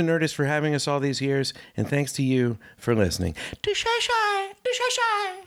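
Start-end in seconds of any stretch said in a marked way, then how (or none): 3.6-5.4 silly high chant. Acted. Silly sign-off noises.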